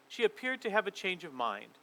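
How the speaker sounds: ordinary delivery, nothing marked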